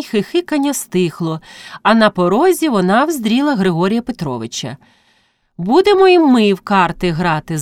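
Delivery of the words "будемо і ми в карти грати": ordinary delivery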